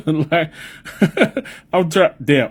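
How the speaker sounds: helpless giggle between words; trying not to laugh. Real, not acted. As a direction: fight down a laugh and fail. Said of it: "I'm trying not to laugh".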